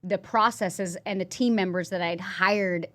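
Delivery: ordinary delivery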